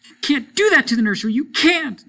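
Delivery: put-on high, loud, fast child's scream; hysterical yell. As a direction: shriek it at the parents in a frenzy. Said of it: "They yelled and sobbed and swore".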